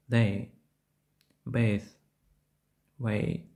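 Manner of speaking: "Day", "Bathe", "Way" with E gliding into I; дифтонг ей. Shown as a demonstration. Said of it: "Вимовляється подібно до A". ("Day", "Bathe", "Way" з переходом від Е до І)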